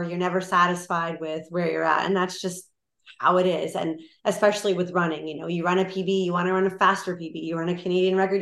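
normal talking voice

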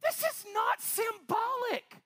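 high, slow, shouted insistence; slow it down and declare it; emphatic shout. Real, not acted. Preacher shouting to his congregation, emphatic.

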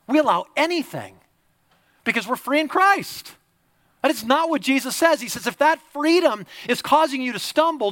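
high, loud, driving sermon delivery; impassioned preaching. Real, not acted. Preaches at full force.

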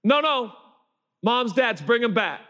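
high, carrying call; urgent summons, pitched well up; Jesus calling parents back. Acted. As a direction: call across a crowd to parents. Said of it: "the Bible says Jesus is".

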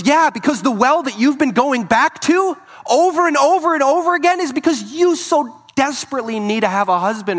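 loud, high, pressing voice of Jesus; fervent preaching. Acted. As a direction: drive it home with rising urgency. Jesus voiced, rising to a climax.

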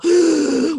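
sudden high gasp of surprise; sharp gasp. Real, not acted. Catching her own mix-up, a sharp gasp.

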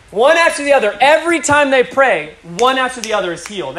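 high, loud testimony climax; fervent preaching. Real, not acted. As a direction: drive it home to the crowd, phrase by phrase.

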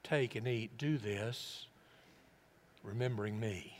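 slow, low and soft, with long pauses; solemn, tender. Acted. Preacher voicing Jesus at the Last Supper, solemn and soft. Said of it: "what was the inflection when he said".

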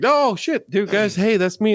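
high, excited outburst; excited realization. Pretend. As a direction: suddenly realize it's you. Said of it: "you're like".

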